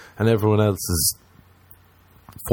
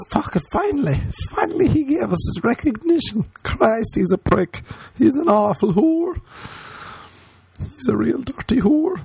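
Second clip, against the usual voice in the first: very high mock Irish woman, grumbling; Irish women's voice; 14 semitones higher than usual. Irish women hearing his podcast mock him in a high voice. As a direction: squeak it out like an offended Irish woman. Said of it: "Some Irish women listening to this be like".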